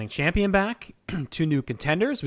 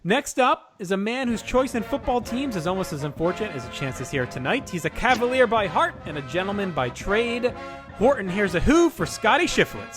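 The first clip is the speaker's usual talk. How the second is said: upbeat host intro; game show introduction; 6 semitones higher than usual. Acted. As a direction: hype up the contestant. Contestant introduction.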